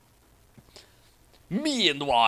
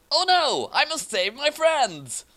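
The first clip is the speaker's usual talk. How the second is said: much higher, slow hammed-up heroic voice; heroic kindness; 11 semitones higher than usual. A narrator's reading. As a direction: play the noble hero, overdone. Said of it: "Awesome said kindly and generously".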